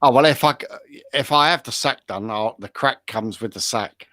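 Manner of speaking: ordinary talk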